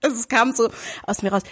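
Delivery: ordinary delivery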